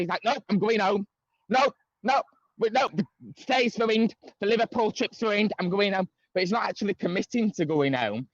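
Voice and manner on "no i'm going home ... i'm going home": imitating aggressive raised voice. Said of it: "an elevated voice with aggression".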